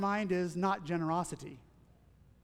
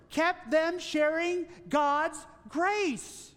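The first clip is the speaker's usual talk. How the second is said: fervent pulpit preaching, raised and driving; rising preaching; 6 semitones higher than usual. Acted. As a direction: preach it with building conviction. Preaching rises to full force.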